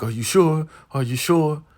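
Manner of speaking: high, anxious questioning, lightly imitated; mock afraid. Marva seeking reassurance about her position, mildly afraid. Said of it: "Like, she is afraid".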